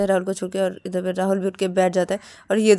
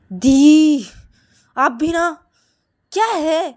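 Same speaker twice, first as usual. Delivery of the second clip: loud, raised complaint; exasperated protest; 8 semitones higher, 10 dB louder, much slower than usual. Acted. Rahul reproaches his sister for fighting, exasperated. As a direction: protest to an older sister, fed up.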